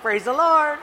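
high, loud Praise the Lord; loud praise greeting. Retold or imitated. Pastor greeting another church's potluck with a loud, high praise. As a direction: call it out brightly to the room.

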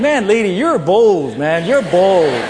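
slow, loud amazed "you're bold"; loud, slow, awed "Man, lady, you're bold". Retold or imitated. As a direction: marvel at her nerve. Amazed inner reaction.

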